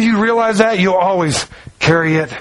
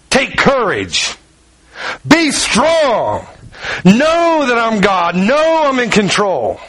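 slow, steady comfort; slow and reassuring; reassuring Jesus; 3 semitones higher, much slower than usual. Retold or imitated. Jesus encouraging the man, slow and steady.

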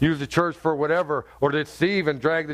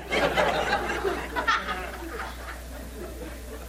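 laughter; 7 dB quieter than usual. Real, not acted. Laughs at own joke.

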